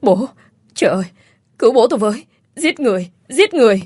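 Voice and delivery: high-pitched panicked calls, held back in volume; acted distress in short, separate cries; narrated cry for help. A narrator's reading. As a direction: call out in alarm, pitch climbing. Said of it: "Khả Hân hét lớn".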